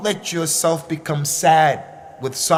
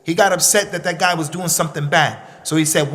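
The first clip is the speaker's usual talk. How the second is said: ordinary talk, nothing marked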